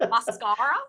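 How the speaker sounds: questioning, badly faked Australian; amateur Australian accent. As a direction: try the word in mock Australian, rising at the end. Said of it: "My really bad Australian accent".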